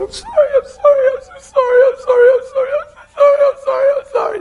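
imitated sobbing wail, very high and loud; overwhelmed crying. Husband imitating his overwhelmed wife apologizing, high tearful wailing. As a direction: wail it like someone crying too hard to talk. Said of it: "They're crying, and they can't hardly talk".

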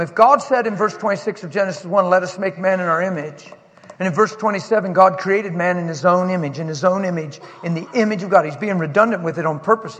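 loud, fast building sermon; fiery preaching. Acted. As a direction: preach it with rising fire. Preaching at full force.

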